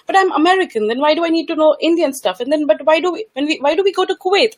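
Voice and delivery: a hint of a puzzled child asking; quoting her son. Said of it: "My son says".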